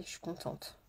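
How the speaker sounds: breathed without voice, very soft; a quiet whispered aside. Shown as a demonstration. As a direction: say it as a secret whisper. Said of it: "j'ai vraiment passé une très bonne journée".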